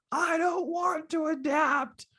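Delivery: put-on very high, drawn-out child wail; squealed, slow imitation of a whining kid; tantrum. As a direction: mimic a toddler refusing in a tantrum. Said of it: "he's throwing this tantrum saying".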